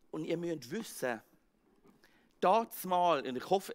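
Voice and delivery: ordinary delivery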